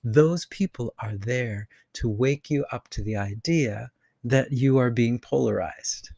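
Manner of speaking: normal talking voice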